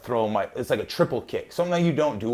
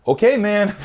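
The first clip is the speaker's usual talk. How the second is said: mock Bas Rutten; 10 semitones higher, 8 dB louder than usual. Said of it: "Bas Rutten's like".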